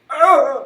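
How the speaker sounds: high loud shriek of panic; panicked shriek. Retold or imitated. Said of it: "she's just like".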